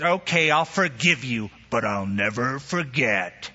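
a hint of a bitter grudge-holder; grudging voice. Preacher voicing the grudge-holder, lightly bitter. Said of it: "the attitude that says".

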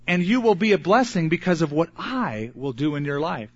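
ordinary talk, nothing marked

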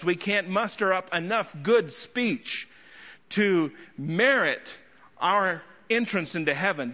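ordinary talk

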